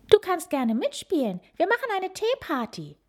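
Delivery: put-on high sweet girl's voice; inviting little girl. A narrator's reading. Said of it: "sagt Pippa einladend".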